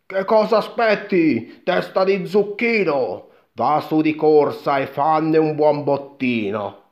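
high, loud, impatient order; urging mother. A narrator's reading. Mother sends her son up the beanstalk, loud urging. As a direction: push him to get moving.

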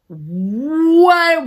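drawn-out shocked high what; long stunned what. Retold or imitated. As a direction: voice the panic of the home team. Home team freaking out.

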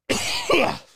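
loud retching cough; real gagging cough. Real, not acted. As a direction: gag hard on the stench. Gagging at the smell.

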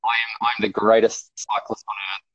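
slow, self-satisfied boasting; mock grandiose boast. Rider boasting to himself about his watts, slow and smug. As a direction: savour it like a champion. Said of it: "And I'm like".

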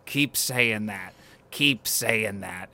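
slow, drawn-out taunt; stretched-out, half-laughed repetition; retold mock laugh. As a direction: imitate a man laughing it off, slowly. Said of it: "three times he's, like, laughing".

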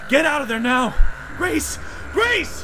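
loud high desperate shout. A narrator's reading. Running and shouting into the phone for Grace. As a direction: yell it while running. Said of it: "shouting into the phone".

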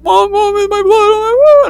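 high, loud panicked call for mom; mock-panic, calling out to mom; imitating panicked cry. Girl shouting to her mom in mock panic over blood.

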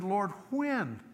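high, bewildered question; baffled plea. Retold or imitated. Man baffled, asking the Lord when he did wrong.

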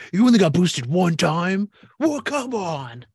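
high, put-on scolding, slowing at the end; mock yelling. Friend scolding him over getting boosted once, mock yell. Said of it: "probably yell at me for that".

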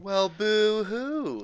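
slow whiny boo-hoo; sarcastic fake sob sound; mock crying. Mocking sympathy for losers.

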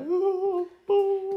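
high, soft imitating of a scared little girl; lightly whimpering ooh; mock whimper. Daughter Skylar whimpering when her dad raises his voice. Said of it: "Skylar be like".

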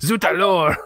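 mock French outburst, playfully overdone; French accent. Co-host joking about cartoony French, exaggerated French accent. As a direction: exclaim in a hammed-up French accent. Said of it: "the French accent when it's not overdone".

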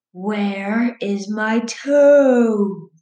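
slow, drawn-out moaning ghost voice; ghostly groan.

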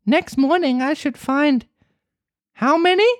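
high, eager puppet voice; high excited boy; growing wonder. Acted. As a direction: gasp it out in mounting wonder. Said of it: "with growing wonder".

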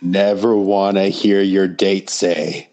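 drawn-out, deliberate list-title delivery; slow announced punchline setup. Pretend. Cohost completes the host's story setup, slow and drawn out. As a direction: stretch each word out at normal pitch.

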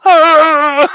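high, loud falling scream; mock plummeting Muppet wail; Kermit. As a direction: scream like a frog puppet falling off a building. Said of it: "Kermit falling off the building".

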